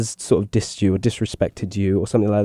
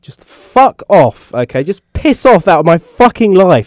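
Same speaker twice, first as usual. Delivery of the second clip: angry brush-off; 9 semitones higher, 7 dB louder than usual. Retold or imitated. Retold mugger rebuff, angry quote.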